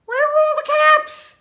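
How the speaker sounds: high, loud, fast cry of alarm; high-pitched panicked question. Acted. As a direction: burst out in storybook shock. Peddler's caps are missing.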